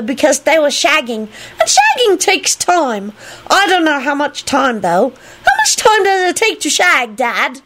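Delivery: put-on high little-kid voice, drawn out; high, guileless child voice; chattering child. A narrator's reading. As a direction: prattle on innocently to Dad. Child chattering on to his dad.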